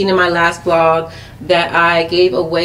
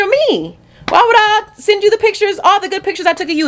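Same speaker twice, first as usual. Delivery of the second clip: indignant rant; 12 semitones higher than usual. Real, not acted. Ranting at the people who asked.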